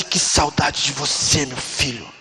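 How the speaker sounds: low, slow and hushed at the end; tender fatherly longing. Retold or imitated. Preacher voicing God welcoming a child home, tender longing.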